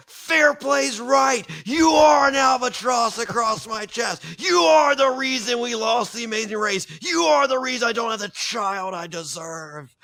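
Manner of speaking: mock-yell the words back at his wife; retold screaming. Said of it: "screams it back".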